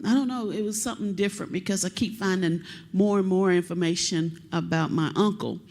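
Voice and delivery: normal talking voice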